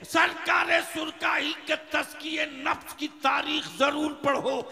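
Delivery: loud, driving litany of exhortations; full-force preaching. Real, not acted. Preacher urging his community to read their history, full-force preaching. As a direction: pound out each urging to the crowd.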